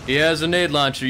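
ordinary talk, nothing marked

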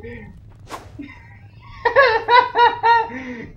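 long high-pitched cackle; hysterical laugh. Real, not acted. Player in fits at the game creature, hysterical laugh. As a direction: laugh helplessly, very high and loud.

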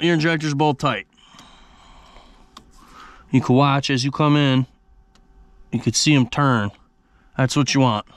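normal talking voice

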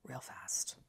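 gefluisterd en dreigend; whispered threat. Real, not acted. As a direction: fluister het als een plagerige dreiging. Menacing whispered repeat.

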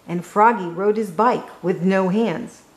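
usual speaking voice

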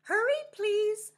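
high, loud urgent plea. Acted. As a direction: beg him to hurry. Striped fish pleading in storm.

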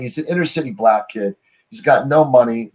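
ordinary talk, nothing marked

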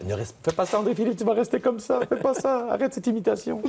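high scolding grandmother, laughing at the end; grandmother's voice. Retold or imitated. Grandma begs the boy to stop imitating, high mock scolding. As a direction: plead like a worried old grandma. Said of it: "ma grand-mère m'a dit".

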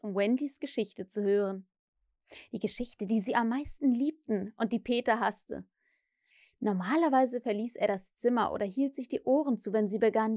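plain reading voice

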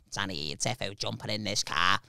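low, very soft put-on voice; Chris. Friend on Monday morning by the car, low soft voice. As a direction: murmur it in his accent. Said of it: "Funny accent".

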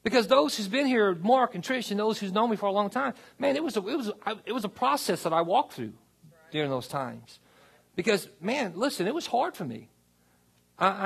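impassioned pastor rousing the church; full-force preaching. Acted. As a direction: preach it out to the back row.

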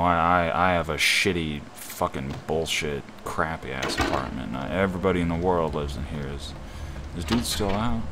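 normal talking voice

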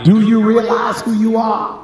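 high, loud call to the congregation; preacher's call. Real, not acted. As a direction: call it out for them to repeat. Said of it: "say it with your chest".

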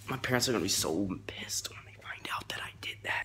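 hushed secret whisper. Real, not acted. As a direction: whisper it so nobody hears. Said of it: "whispered aside about parents".

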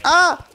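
high, loud cry cutting in; startled yelp. Real, not acted. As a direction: break off with a sudden yelp. Interrupted by a hit.

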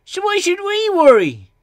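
very high, loud challenge; loud, high-pitched objection; objecting. A narrator's reading. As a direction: protest in a high little-engine voice. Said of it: "objected Ben".

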